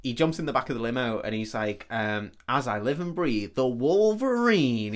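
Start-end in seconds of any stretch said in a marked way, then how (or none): 2.5-4.9 slow, stretched Southern drawl on Wolverine. Retold or imitated. Retelling a film villain's greeting in a slow Southern drawl. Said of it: "He's got a real southern drawl to him".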